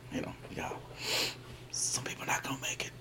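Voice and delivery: ominous hushed whisper. Acted. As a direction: whisper it darkly. Unvoiced whisper.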